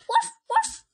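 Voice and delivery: high, playful woof; puppy bark. Acted. Child barks as Biscuit.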